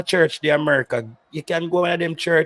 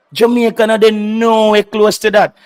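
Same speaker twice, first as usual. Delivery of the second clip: heated; 5 semitones higher, 6 dB louder than usual. Real, not acted. Heated comparison with Austria.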